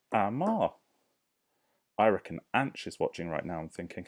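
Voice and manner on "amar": "Amar" sounded out in two clear parts; split into syllables. Shown as a demonstration. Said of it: "my name has two syllables".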